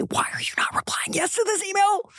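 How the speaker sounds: high, breathy whispered exasperation; judgy inner whisper. Retold or imitated. Mother's silent anger at her daughter, whispered. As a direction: whisper the angry thought to yourself. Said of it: "I could feel the judgment and anger".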